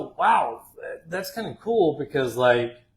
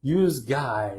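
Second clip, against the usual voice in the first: "Yous guys" in a Jersey accent; Jersey City "Yous guys"; 3 dB louder than usual. Shown as a demonstration. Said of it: "that's like Jersey City".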